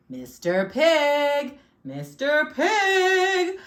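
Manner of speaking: loud, high, long-held call. A narrator's reading. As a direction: holler for the pig, drawing out each word. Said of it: "I called".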